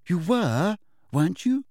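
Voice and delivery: mildly anxious, high questioning lilt. A narrator's reading. Hermione checking on Harry's behaviour, mildly anxious. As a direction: press for reassurance with a touch of worry. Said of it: "looking up anxiously".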